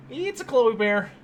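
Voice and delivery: high, slow pet-name coo; slow singsong doggy talk. Real, not acted. Baby talk to dog.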